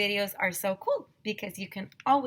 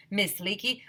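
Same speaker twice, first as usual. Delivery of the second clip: usual speaking voice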